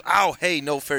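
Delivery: hammed-up "hey, unfair" objection; mock protest. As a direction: act out a cartoon complaint. Acting out Glob punching himself.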